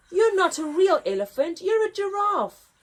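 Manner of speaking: loud, high trumpeting jeer; trumpeting elephants. Acted. Elephants laughing off the giraffe, trumpeting. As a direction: blare it like elephants. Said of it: "they trumpeted".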